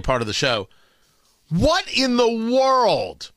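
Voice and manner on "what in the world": slow, drawn-out bafflement; slow, exasperated wondering; incredulous. Real, not acted. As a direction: stretch out every word in disbelief. Baffled rhetorical question about town hall.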